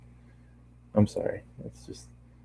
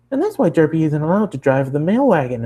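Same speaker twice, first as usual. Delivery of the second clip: bubbly, much higher cartoon pony voice; high Pinkie voice; 6 semitones higher than usual. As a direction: chatter it up high like an excited pony. Pinkie voiced in read story.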